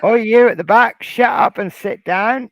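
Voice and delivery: bossy bingo-hall shout; loud heckle. Pretend. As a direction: shout it loud at the back row.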